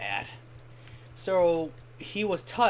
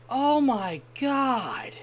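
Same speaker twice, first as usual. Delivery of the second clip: horrified at the awful singing; drawn-out disgust; 7 semitones higher, much slower than usual. Retold or imitated. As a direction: stretch "Oh my God" out slowly, appalled. Disgusted reaction to bad singer.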